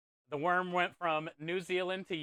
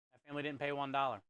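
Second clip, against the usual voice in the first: faster, softer echo of the line; quick quiet repeat; 2 semitones lower, 5 dB quieter, faster than usual. Shown as a demonstration. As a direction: toss it off again under the breath.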